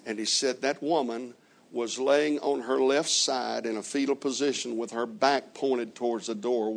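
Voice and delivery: ordinary talk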